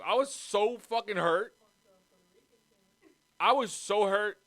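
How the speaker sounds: usual speaking voice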